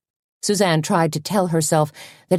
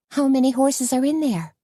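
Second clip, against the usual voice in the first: higher, lightly voiced friend; a higher lilt for Tony; Tony's voice; 9 semitones higher than usual. A narrator's reading. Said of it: "Tony asked".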